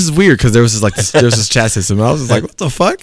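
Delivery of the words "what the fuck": high rising disbelief; incredulous high-pitched question; baffled reaction. Retold or imitated. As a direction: pitch it up, totally confused. Acts out baffled reaction to message.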